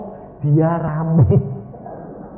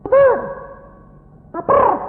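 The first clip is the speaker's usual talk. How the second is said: very high, loud cry; hungry yelling; 4 dB louder than usual. Acted. Revived patient yells that he is hungry, high and loud. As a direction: wail it out urgently.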